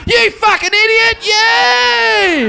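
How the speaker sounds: very high, loud jeering cheer; imitated crowd yelling. Thousands of fans abuse the comic, imitated screaming cheer. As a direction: scream abuse like an adoring mob. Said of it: "thousands of people going".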